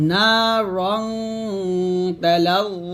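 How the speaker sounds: না stretched, রং held long on a nasal hum; drawn-out ghunnah. Shown as a demonstration. Teacher modelling the final pronunciation, long hummed রং. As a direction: chant it slowly, lingering on the "ng" of রং. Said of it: "তাহলে আমরা এখানে উচ্চারণটা এরকম করব".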